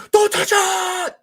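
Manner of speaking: very high, slow, loud squeal; mock dubbed-movie cry. Mimicking a badly dubbed movie line, high squeal. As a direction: wail it like a badly dubbed actor. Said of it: "it's like".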